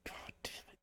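barely audible whispered curse; very soft, exasperated whisper; muttered frustration. Retold or imitated. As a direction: swear under your breath. Muttered reaction to losing sale.

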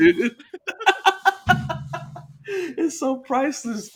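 giggling high through the words; can't stop giggling; laughing. Real, not acted. Laughing at the prank.

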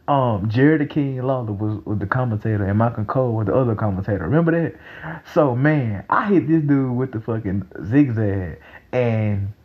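ordinary talk, nothing marked

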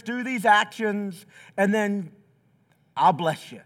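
a hint of a booming divine promise; mock God voice. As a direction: play God promising an easy fix, hammed up. Mock God promising easy fix.

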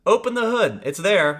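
mock blunt manual. Owner's manual bossing a clueless driver, loud mock curt. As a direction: shout the lazy instruction, hammed up. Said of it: "if your owner's manual says".

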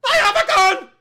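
high, very loud robber's yell; full-force high holler; screamed threat. Acted. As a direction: scream it out to the whole bank. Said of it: "don't just scream out".

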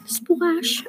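plain reading voice

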